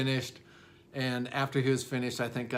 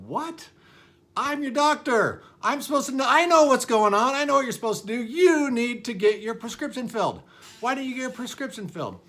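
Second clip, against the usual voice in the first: high, loud, indignant scolding of a patient; exaggerated doctor outrage, shouted high; mock outraged doctor; 11 semitones higher, 8 dB louder than usual. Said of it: "it wasn't that intense".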